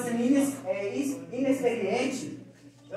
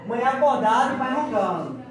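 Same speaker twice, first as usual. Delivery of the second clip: normal talking voice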